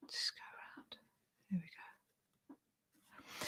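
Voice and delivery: whispered murmur to oneself while concentrating; very hushed, absorbed in careful work; barely audible self-talk; gone quiet. Real, not acted. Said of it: "I'll probably go quite quiet".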